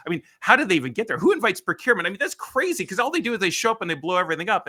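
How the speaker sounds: high-pitched, incredulous venting; animated mock exasperation; retold exasperated rant. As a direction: imitate someone worked up and baffled. Retold rant about procurement.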